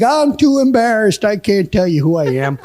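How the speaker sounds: imitated old fellow on a prank call; old man. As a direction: sweet-talk her like an elderly admirer. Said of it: "pretending I was an old man".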